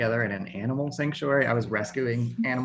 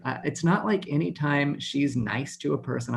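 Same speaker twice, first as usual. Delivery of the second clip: normal talking voice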